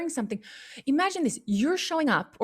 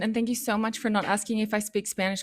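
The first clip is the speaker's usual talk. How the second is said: usual speaking voice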